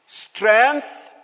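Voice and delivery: ordinary talk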